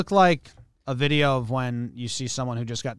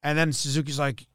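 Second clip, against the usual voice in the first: normal talking voice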